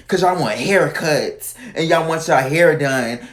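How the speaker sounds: usual speaking voice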